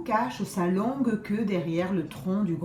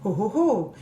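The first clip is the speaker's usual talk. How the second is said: usual speaking voice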